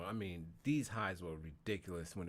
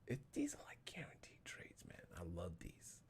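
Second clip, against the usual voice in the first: whispering; 3 semitones lower, 11 dB quieter than usual. Real, not acted. Quiet aside about guaranteed trades.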